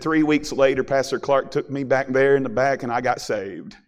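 ordinary talk, nothing marked

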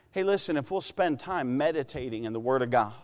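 ordinary talk

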